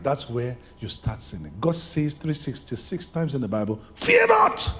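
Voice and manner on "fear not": high ringing "Fear not"; triumphant preacher's cry; shouted proclamation. Acted. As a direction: belt it out to the church.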